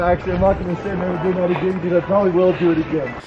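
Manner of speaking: ordinary talk, nothing marked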